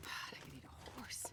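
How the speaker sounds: breathy whisper under the breath; whispered aside. Real, not acted. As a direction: whisper it to yourself, barely audible. Muttering to self while playing.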